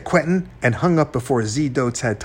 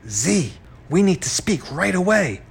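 agitated, higher-pitched urgency; upset; 6 semitones higher than usual. A narrator's reading. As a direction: press urgently, voice raised in pitch. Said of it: "sounding very upset".

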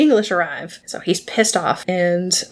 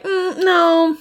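mock refusal; 9 semitones higher, 3 dB louder than usual. Crew refusing Olu as roommate.

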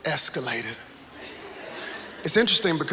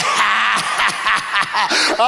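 hammed-up ha-ha of mocking friends; mock laughter; much slower than usual. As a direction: imitate people laughing at you. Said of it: "they're laughing at me".

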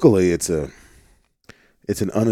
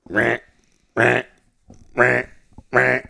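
alarm duck call; 4 semitones higher, slower than usual. Shown as a demonstration. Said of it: "that alarm".

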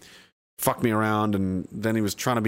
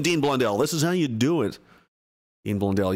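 ordinary delivery

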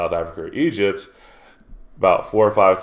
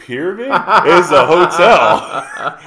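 laughing retort; 7 semitones higher, 6 dB louder, much slower than usual. Retold or imitated. Retelling what she told the kid.

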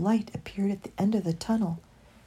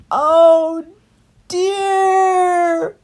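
loud, high wail; high, drawn-out cry of dismay; distressed cry; 10 semitones higher, 10 dB louder than usual. A narrator's reading. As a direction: wail it out in despair. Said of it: "cried Christopher".

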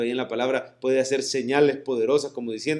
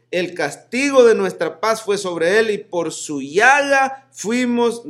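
loud, raised-pitch reading of the verses; solemn scripture reading; 4 semitones higher, 5 dB louder than usual. Acted. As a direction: proclaim the prophecy to the congregation. Reads Isaiah aloud to congregation. (lectura solemne y enfática de la Escritura)

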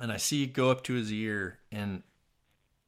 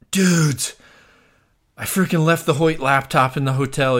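panicked; 4 semitones higher, 8 dB louder than usual. Retold or imitated. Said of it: "totally worried about it".

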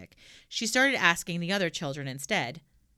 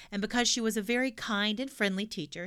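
ordinary delivery

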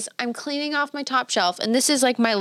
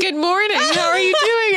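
high, louder chirpy neighbor voice; neighbor's greeting; 9 semitones higher, 4 dB louder than usual. Retold or imitated. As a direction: play the cheerful neighbor much higher. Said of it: "He said".

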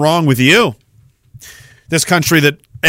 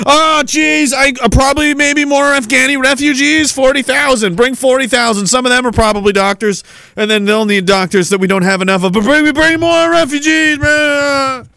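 mock panicked officials; 7 semitones higher than usual. Nova Scotia officials complaining about no doctors, hammed-up panic. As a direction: ham up helpless government worry.

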